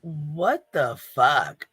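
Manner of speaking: drawn out slowly in shock; stunned disbelief. Real, not acted. Hearing a cruel remark on a livestream, slow stunned disbelief. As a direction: let it stretch, dumbfounded.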